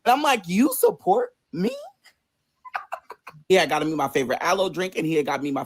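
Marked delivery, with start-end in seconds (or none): 0.5-1.7 slow, high incredulous question; touched disbelief. Retold or imitated. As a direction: ask it slowly, pitched up in disbelief. Touched disbelief at boyfriend's visit.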